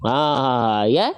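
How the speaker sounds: ordinary delivery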